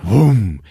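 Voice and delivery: sudden vocal burst of flame; imitated whoosh of ignition. Storyteller shows both catching fire, imitated whoosh.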